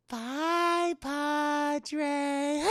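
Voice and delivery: high, drawn-out creepy bye; possessed girl's eerie farewell; creepy sing-song goodbye. Retold or imitated. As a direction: taunt him with a sweet goodbye. Possessed girl waves off the priest.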